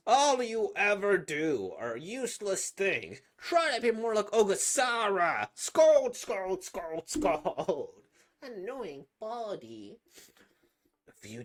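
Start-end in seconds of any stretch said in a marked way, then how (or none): 0.1-5.5 angry scolding. Acted. Parent scolding. 5.5-7.9 loud. Acted. Mocking scolding.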